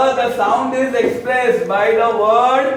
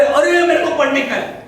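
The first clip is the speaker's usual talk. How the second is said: put-on whiny child; 6 semitones higher, 5 dB louder than usual. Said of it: "वो sound है ना".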